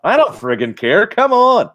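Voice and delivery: loud, rising to high on come on; eager shout. Pretend. Collector answering a scuffed toy, an eager shout. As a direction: wave off the flaw, eager. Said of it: "like, like".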